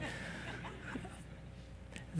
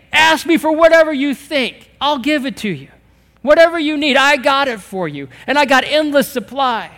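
higher, louder, cocky come-on; show-off challenge; 7 semitones higher, 6 dB louder than usual. Pretend. Holy Spirit daring believers to bring their needs, in a cocky, playful challenge. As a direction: dare them to ask for more. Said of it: "the Holy Spirit loves to show off".

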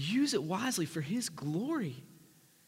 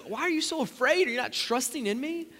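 raised, urgent questioning of the disciples; Jesus reassuring; 7 semitones higher, 4 dB louder than usual. Acted. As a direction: press them to trust. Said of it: "he's like".

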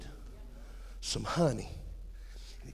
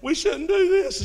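high, pleading mock cry; high-pitched mock-tearful plea; wife crying; 12 semitones higher, 6 dB louder than usual. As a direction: imitate the wife begging him to stop. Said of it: "Holly's crying".

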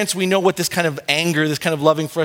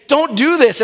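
parent's warning; 8 semitones higher, 4 dB louder than usual. Retold or imitated.

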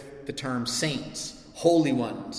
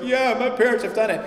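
put-on higher, louder smug teen voice; mocking kid; 8 semitones higher, 7 dB louder than usual. Preacher imitating smug kids in the congregation. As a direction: toss it off like a cocky kid. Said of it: "kids who are like".

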